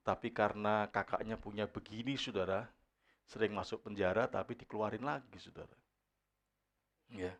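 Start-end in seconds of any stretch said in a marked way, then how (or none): none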